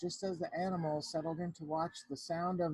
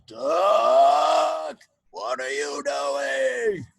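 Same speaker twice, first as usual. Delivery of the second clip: loud, stretched slow yell of disbelief; exasperated shout; 12 dB louder, much slower than usual. Acted. Farmer shouting at the duck down the phone, loud and slow. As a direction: yell at the naughty duck, slowly.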